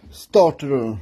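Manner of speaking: "starter" in a lousy put-on English accent; bad English accent. Mechanic mocking his own English, bad English accent. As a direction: say "starter" like a Frenchman faking English. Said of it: "T'as vu cet accent anglais de merde?".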